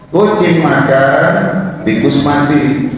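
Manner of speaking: slow "Kucing makan" rising, break, "tikus mati"; pause in the middle. Shown as a demonstration. Teacher showing students the middle comma, pause after "makan". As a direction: split into two halves after "makan". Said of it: "Kalau saya taruh tengah-tengah nih komanya".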